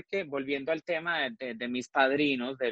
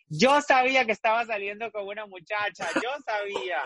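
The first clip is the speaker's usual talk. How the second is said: higher, laughing I-knew-it voice; teasing friend, laughing; 7 semitones higher than usual. Retold or imitated. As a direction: play the friend who guessed all along, giggling. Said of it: "y me dice".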